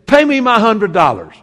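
loud, harsh demand for the money; angry demand. Retold or imitated. Forgiven debtor demanding his hundred dollars, loud and harsh. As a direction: bark it like a merciless creditor.

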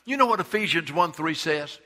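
normal talking voice

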